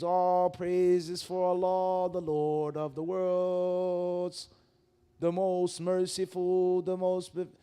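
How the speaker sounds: slow, drawn-out recited translation; mock slow recitation. Mocking the English prayer translation, slow and solemn. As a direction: intone the English slowly like a prayer. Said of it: "you don't do that".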